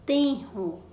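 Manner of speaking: "Tenro" in two careful syllables; strong R demonstration. Shown as a demonstration. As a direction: give "Tenro" a strong breathed R after the N. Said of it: "o som do R forte no meio da palavra". ("Tenro" silabado, com R forte)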